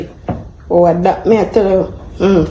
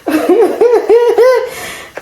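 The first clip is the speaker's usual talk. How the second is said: laughing. Real, not acted. Laughing at failed cooking.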